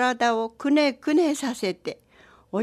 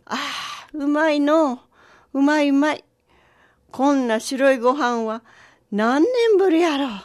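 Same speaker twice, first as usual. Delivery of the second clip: higher, louder relish, swelling at the end; delighted old man; 3 semitones higher, 4 dB louder, faster than usual. Acted. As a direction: savour it with heartfelt wonder.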